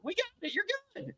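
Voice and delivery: high, soft reassurance; calm him down gently; lightly voiced doctor reassuring the patient.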